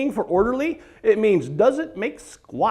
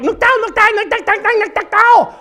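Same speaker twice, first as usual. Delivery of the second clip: higher, babbling fake Korean gibberish; mock foreign chatter; 11 semitones higher than usual. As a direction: chatter the nonsense high. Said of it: "they're like".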